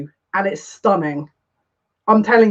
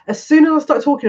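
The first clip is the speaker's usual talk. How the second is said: higher, louder and fast, words tumbling out; excited rush; 7 semitones higher, 4 dB louder, faster than usual. Real, not acted. Reader to her viewers, bursting with the vision: excited rush.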